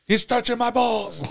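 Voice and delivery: high-pitched squealed protest, played for laughs; mock kid complaint. Wrestling kid objecting to the other, high mock complaint. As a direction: put on a high, whiny kid voice.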